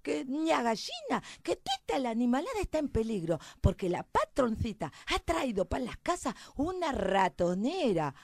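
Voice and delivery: high, loud country mouse voice; alarmed little mouse. Acted. Mouse tells the hen about the mousetrap, alarmed.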